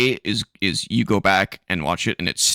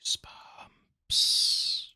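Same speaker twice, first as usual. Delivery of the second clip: slow, soft stage whisper; drawn-out whisper; 8 dB quieter, much slower than usual. Pretend. Host signs off the show's title, slow spooky whisper. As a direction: whisper it very slowly.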